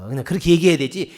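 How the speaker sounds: ordinary talk, nothing marked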